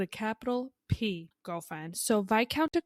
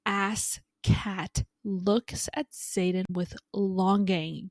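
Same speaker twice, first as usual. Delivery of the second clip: ordinary delivery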